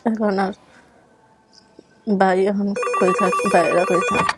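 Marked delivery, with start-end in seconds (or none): none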